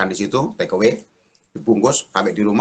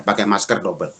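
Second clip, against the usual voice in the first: ordinary talk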